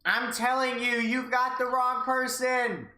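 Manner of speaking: protest, flustered and slow; flustered young man. Acted. Voices Satoshi protesting.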